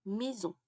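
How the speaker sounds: "Maison" with the S voiced as a Z. Shown as a demonstration. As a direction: say "Maison" with a buzzing Z between the vowels. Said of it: "le S a un son Z".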